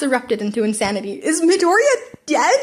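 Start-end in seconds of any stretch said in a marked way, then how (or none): 1.1-2.6 shocked student. Acted. Class erupts in shock.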